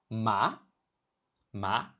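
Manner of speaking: MA starting mid and rising sharply; second tone. Shown as a demonstration. Teacher demonstrating to learners, MA in a rising second tone. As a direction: ask MA like a surprised question. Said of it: "starts mid and rises sharply".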